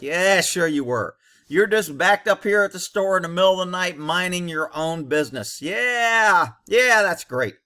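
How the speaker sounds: drawn-out, mock-agreeing yeahs; sarcastic disbelief. Jaded cop answering a minding-his-own-business excuse, sarcastic disbelief. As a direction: pretend to buy a lame excuse, dripping doubt.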